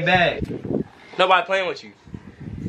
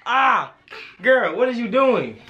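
ordinary talk, nothing marked

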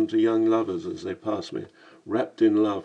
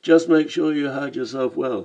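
Cockney voice; 4 semitones higher, 4 dB louder than usual. Acted. Lamb advising, Cockney voice.

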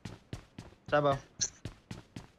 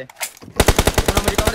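cover me shout; 5 semitones higher, 9 dB louder, much slower than usual. Real, not acted. Calling for cover mid-fight.

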